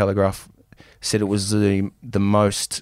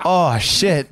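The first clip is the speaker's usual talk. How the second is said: laughing dismay; 8 semitones higher than usual. Real, not acted. Realising he's the villain.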